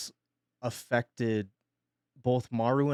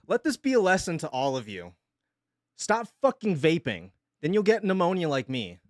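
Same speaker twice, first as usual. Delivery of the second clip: mock lecture; 5 semitones higher, 5 dB louder than usual. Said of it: "That's sort of a joke".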